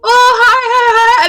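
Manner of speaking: imitated high-pitched happy hellos; excited, sing-out greeting; cheery greeting. Acting out coworker's cheery greeting.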